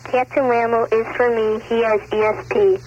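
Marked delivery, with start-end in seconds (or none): none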